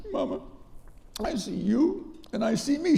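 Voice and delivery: high-pitched tearful child, retold; imitated crying. Crying boy answering his mother, imitated tearful voice. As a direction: plead with mama as a crying child. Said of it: "So I'm crying".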